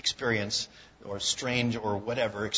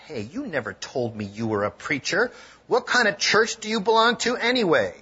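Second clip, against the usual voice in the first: a hint of hostility, louder at the end; trace of hostility; 6 semitones higher, 6 dB louder than usual. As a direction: challenge him, mildly suspicious. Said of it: "with a trace of hostility in his voice".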